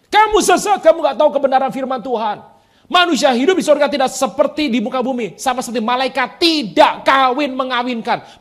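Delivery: loud, high accusing rebuke; stern condemning shout; rebuking Jesus line. Retold or imitated. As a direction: scold them as Jesus rebuked the Sadducees. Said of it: "Orang Saduki".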